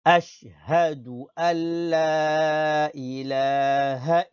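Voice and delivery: long-held vowels intoned on one breath; continuous recitation. Shown as a demonstration. Teacher reading the whole testimony for pupils, unbroken chanted recitation. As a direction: chant it through without stopping, level and sustained. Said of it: "lire en entier sans s'arrêter".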